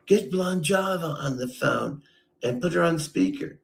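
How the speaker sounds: mock bossy order. Imitating Ray ordering a call to Blonde Java, mock bossy. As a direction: bark it like an order to a driver. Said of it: "And you're like".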